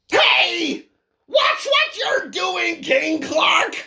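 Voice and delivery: high shout, slow on the warning; alarmed shout. Acted. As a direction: yell at the clumsy chicken to watch out. Shouting at giant chicken.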